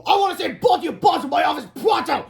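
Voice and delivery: high, loud furious shout; angry yell. A narrator's reading. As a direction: bark orders at the boys. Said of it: "yelled Melvin".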